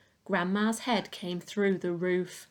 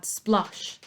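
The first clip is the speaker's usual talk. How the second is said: plain reading voice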